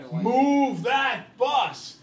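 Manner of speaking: very slow, high, loud yell; catchphrase shout. Retold or imitated. TV host roaring at the bus driver, slow loud shout. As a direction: drag out every word at full shout. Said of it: "You remember what he says?".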